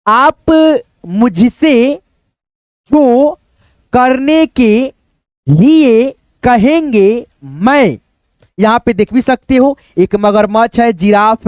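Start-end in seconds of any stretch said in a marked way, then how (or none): 0.1-8.0 put-on slow, deliberate reading to children; slow reading aloud. Teacher reads the genie's words slowly to children. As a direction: read each word out slowly for young readers.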